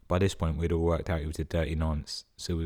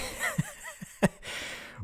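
wheezy silent laugh; 5 dB quieter than usual. Real, not acted. Laughs at own story.

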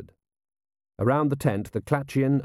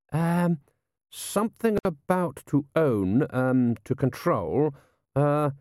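slow, halting, higher-pitched voice; hesitant; 5 semitones higher, slower than usual. Acted. Lieutenant struggling to translate for his lord, hesitant. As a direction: grope for each word between ums.

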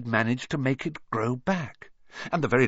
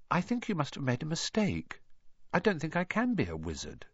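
softly, unsure of himself; a quiet doubtful protest; said quietly; 3 semitones higher, 7 dB quieter than usual. A narrator's reading. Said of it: "he said quietly".

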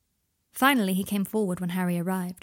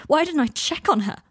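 anguished cry; 8 semitones higher, 8 dB louder than usual. Acted. Draco blames himself, panicking.

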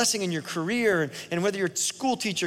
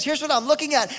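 higher, louder preached line; preaching voice; 8 semitones higher, 3 dB louder than usual. Acted. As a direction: say it as the fired-up preacher. Preacher's own words before God quote.

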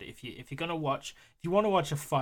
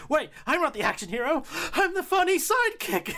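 high, rising mock panic; sidekick realising; 14 semitones higher, 5 dB louder than usual. Retelling the kid's movie moment, high mock panic. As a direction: pitch it up as the sidekick clocks his fate. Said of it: "And he's like".

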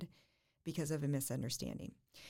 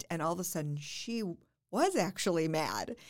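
normal talking voice

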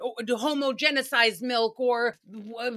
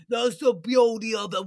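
louder imitation of Sebastian; mock Sebastian; 4 dB louder than usual. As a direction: press the line out louder, insisting. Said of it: "Sebastian's like".

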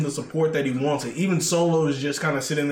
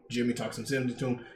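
"Jimmy talks some sense into him" said softer and fast; quieter, quicker repeat; 3 semitones lower, 8 dB quieter, faster than usual. Real, not acted. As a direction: toss the repeat off under your breath.

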